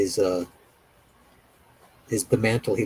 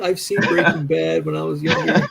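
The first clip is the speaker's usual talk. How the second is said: higher, laughing through a mock quote; 5 semitones higher, slower than usual. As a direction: giggle while imitating the naive kid. Mocking quote of young people.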